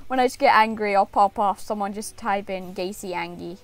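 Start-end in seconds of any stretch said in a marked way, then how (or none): none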